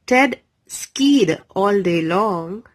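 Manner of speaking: ordinary delivery, nothing marked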